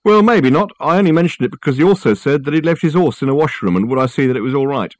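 high-pitched porter pressing on; defensive chatty porter. A narrator's reading. Porter explaining why he told the story, defensive and high. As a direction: justify the tale, a touch put out.

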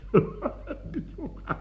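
a high airy laugh without voice; breathless laugh. Acted. Man laughing breathlessly at his own question.

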